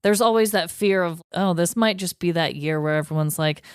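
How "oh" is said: ordinary delivery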